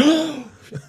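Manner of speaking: high, sharp shocked gasp; imitated gasp. Lady hearing her house is lost, shocked gasp. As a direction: gasp like she's just heard the worst. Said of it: "and she went".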